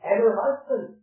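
high, driving sermon delivery; insistent revival preacher, voice lifted; forceful preaching. Real, not acted. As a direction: press each point home to the congregation.

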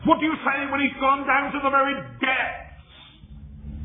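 high, ringing sermon delivery; impassioned preaching. Real, not acted. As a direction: preach it in a high, sustained declamation. Preaches at full force.

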